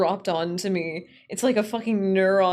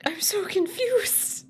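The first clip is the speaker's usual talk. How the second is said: whispered confusion; 12 semitones higher, slower than usual. Real, not acted. Baffled by the model.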